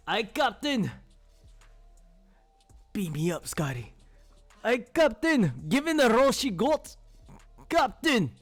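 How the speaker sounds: high-pitched, hammed-up Scottish accent; bad but game Scottish accent; mock Scottish accent. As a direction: play a Scottish ship's engineer calling the captain. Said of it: "That's as good as a Scottish accent".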